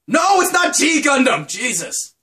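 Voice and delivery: high, loud correction; exasperated outburst. Real, not acted. Vlogger correcting viewers on a title, loud exasperated outburst. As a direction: burst out as if asked too often.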